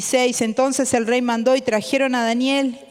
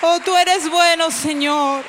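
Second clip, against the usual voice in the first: fervent worship prayer; 5 semitones higher than usual. Acted. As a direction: cry out praise with full force. Leads worship prayer with music.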